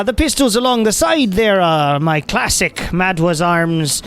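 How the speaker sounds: high, proud gnome arms dealer; gnome shopkeeper voice. Acted. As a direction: sell your guns with pride.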